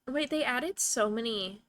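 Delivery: ordinary talk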